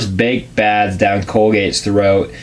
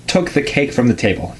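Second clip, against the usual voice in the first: ordinary delivery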